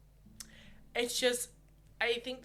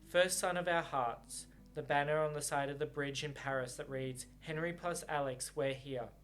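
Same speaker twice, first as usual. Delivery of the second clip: story narration; 3 semitones lower than usual. A narrator's reading. Reader narrating the novel to viewers, calm.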